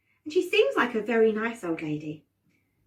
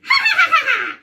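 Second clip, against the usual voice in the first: very high, loud cackle; witchy laugh; 10 dB louder than usual. Shown as a demonstration. Leading everyone on the count of three, witchy laugh. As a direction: let rip a shrieking witch cackle. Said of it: "with a lovely witchy laugh".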